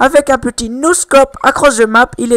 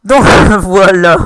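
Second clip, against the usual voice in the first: laughing; 3 semitones lower, 6 dB louder, slower than usual. Real, not acted. Laughs after finishing the accent.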